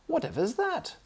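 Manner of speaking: high shocked exclamation; shrill startled aunt; startled cry. Acted. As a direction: jump at the noise. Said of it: "cried Aunt Jemima".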